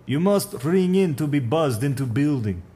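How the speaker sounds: put-on firm Russian accent laying down the rules. Supervisor tells the stranger the building rules in a Russian accent. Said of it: "Russian accent".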